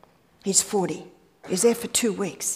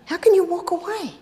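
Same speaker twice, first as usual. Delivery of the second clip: higher-pitched, protesting question; incredulous objection; 8 semitones higher than usual. Acted. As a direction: ask it like someone who can't accept the advice. Voices a listener's objection.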